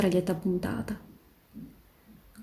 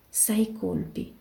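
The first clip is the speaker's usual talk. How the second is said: slow, hushed murmur; whispered; 5 dB quieter, much slower than usual. A narrator's reading. Sniper counts her shots before firing, whispering slowly. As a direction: say it softly and drawn out. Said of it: "sussurrò".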